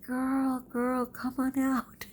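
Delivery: high, long-drawn singsong call; imitated calling out. Teacher coaxing a hidden girl out from the trees, imitated calling. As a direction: call into the distance without real volume. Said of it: "I'm yelling".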